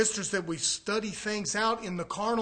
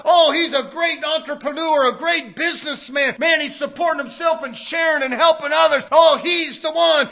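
shouted, much higher admiring praise; mock worldly praise; 8 semitones higher, 13 dB louder than usual. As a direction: gush over him like a fawning crowd.

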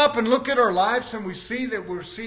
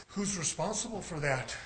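soft, lowered voice; quiet question; 5 semitones lower, 11 dB quieter than usual. Real, not acted. Preacher turning the question on the congregation, quiet question. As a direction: drop right down and ask it softly. Same question asked softly, then forcefully.

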